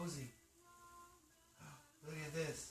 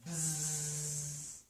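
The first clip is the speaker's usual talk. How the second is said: long, steady buzzing; bee buzz. Acted. Animal noise from board book.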